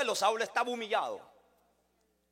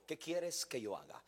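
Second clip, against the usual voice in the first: much softer, humbled question; Saul, humbled on the ground, asks Jesus in a much softer voice; humbled plea; 9 semitones lower, 11 dB quieter than usual. Acted. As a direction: ask it softly, bowed and humble. Saul's humbled words, retold.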